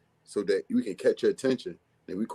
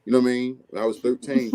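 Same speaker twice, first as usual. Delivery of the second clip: ordinary talk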